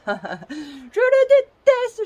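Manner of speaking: laughing while saying it. Real, not acted. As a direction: laugh through the words, high and loud. Repeats hatred of her tripod.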